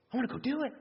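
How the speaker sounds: high-pitched eager outburst; fired up. Acted. Preacher acting out himself when fired up, excited. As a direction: burst out, itching to go. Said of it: "when I'm fired up".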